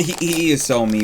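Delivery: ordinary talk, nothing marked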